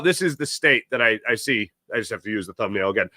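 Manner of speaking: normal talking voice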